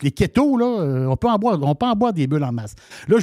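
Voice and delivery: usual speaking voice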